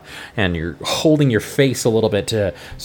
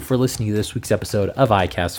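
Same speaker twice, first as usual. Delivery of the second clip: upbeat host sign-off, pitched higher; cheerful plug read, quickening toward the email; podcast outro; 4 semitones higher, faster than usual. Acted. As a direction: thank the listeners and pitch the review, brisk.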